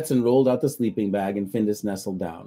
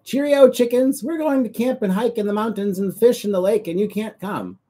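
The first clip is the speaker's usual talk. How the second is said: calling out; 13 semitones higher, 6 dB louder than usual. Acted. Cat taunts the hens while passing, gleeful high call. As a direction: call out to tease the chickens. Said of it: "Findus called".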